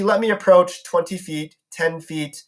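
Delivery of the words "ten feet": plain reading voice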